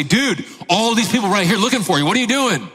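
high-pitched, incredulous mock urgency; urgent disbelief. Disciples confront Jesus about waiting crowd, incredulous. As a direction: imitate agitated followers, pitch pushed up.

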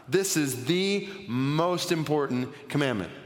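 ordinary talk, nothing marked

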